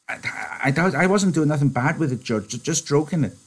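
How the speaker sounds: put-on high, stammering childlike plea; Lenny, pleading. Lenny pleading with George, stammering. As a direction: beg not to be in trouble.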